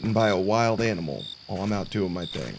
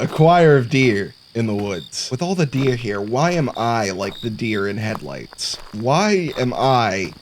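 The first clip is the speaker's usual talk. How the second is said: ordinary talk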